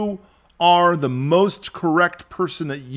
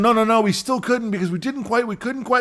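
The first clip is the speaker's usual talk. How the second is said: mock excuses; 7 semitones higher than usual. Politician asked did you get it done, mock defensive. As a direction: rattle off excuses in a high mocking voice.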